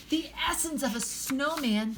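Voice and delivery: high, indignant outburst; disgusted yell. A narrator's reading. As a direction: throw it back in disgust. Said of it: "gave her a disgusted look and yelled".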